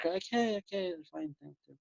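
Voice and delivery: very soft, dismissive reassurance; fading almost to nothing; soothing self-talk. Acted. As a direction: hush the worry away. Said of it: "treat your mind like a baby".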